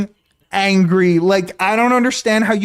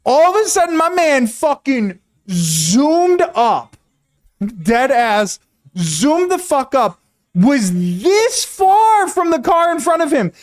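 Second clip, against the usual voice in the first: much higher, worked-up storytelling voice; excited retelling; 7 semitones higher than usual. Real, not acted. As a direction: build the story to its wild peak, slowing on key words. Retelling the angry driver climax.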